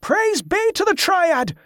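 very high, drawn-out cry of praise; slow, high-pitched triumphant shout; jubilant cry. A narrator's reading. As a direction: cry it out from the crowd. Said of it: "cried a voice".

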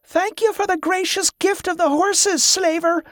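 high-pitched jeering call; gleeful, high, crowing jeer; mocking glee. A narrator's reading. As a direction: taunt a retreating enemy. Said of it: "a woman laughed".

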